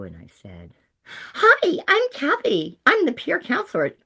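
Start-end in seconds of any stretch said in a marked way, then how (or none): 1.3-4.0 very high, loud, chirpy greeting; over-cheerful high-pitched introduction; happy little voice. Retold or imitated. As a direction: chirp it brightly in a tiny happy voice. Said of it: "and my happy little voice".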